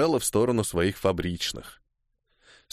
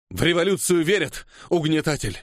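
higher-pitched defiant retort; spat out; 4 semitones higher than usual. A narrator's reading. Striker answering the officer's threat, spitting it out. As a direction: throw the accusation at the oppressor. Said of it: "выплюнул я".

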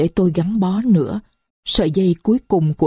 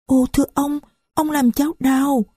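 put-on higher, small voice of a young girl; little girl's voice; 8 semitones higher than usual. Little Marie complains to the stranger, high child voice. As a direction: speak as a little child who is hurt.

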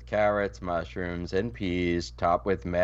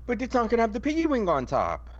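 high-pitched whining complaint; high whiny protest; 13 semitones higher than usual. Real, not acted. Mourning the piggy wing.

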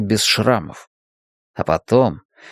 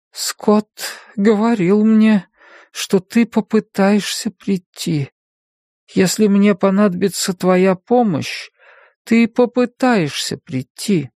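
slow, higher, distant; dreamy sister voice; 9 semitones higher than usual. A narrator's reading. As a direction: speak from far away. Sleepy sister answers.

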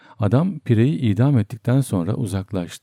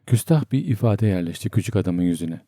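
ordinary delivery

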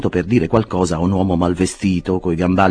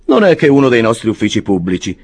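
Jackson the clerk; 5 semitones higher, 5 dB louder than usual. A narrator's reading. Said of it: "rispose Jackson".

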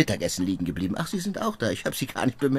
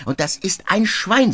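louder, slower, higher scorn; scornful insult; 10 semitones higher, 6 dB louder, slower than usual. Acted. Visitor rails at the absent relative, slow loud scorn. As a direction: spit out the insult with relish.

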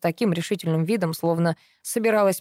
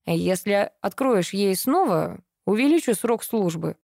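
plain reading voice

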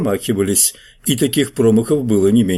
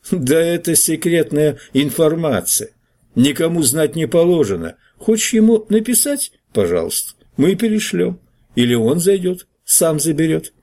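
high-pitched, amused refusal; higher character voice, mockingly official; mocking newspaperman; 7 semitones higher than usual. A narrator's reading. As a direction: pitch it well up, toying with the caller.

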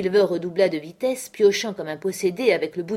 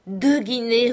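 loud angry roar, higher; 5 semitones higher, 5 dB louder, slower than usual. Acted. Mary roaring at Silver over the coins. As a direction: hurl it at Silver in disgust. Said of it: "rugit Mary".

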